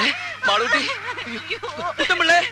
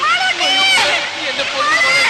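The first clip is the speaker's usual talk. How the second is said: anguished cry; slower than usual. Acted. Father discovers daughter in pit.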